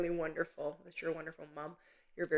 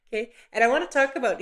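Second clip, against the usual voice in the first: ordinary delivery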